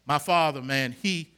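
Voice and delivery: usual speaking voice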